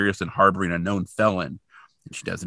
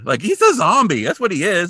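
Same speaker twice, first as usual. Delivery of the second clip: higher, louder realisation; excited; 8 semitones higher, 5 dB louder than usual. Real, not acted. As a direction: blurt it out, thrilled. Excited realisation about zombie.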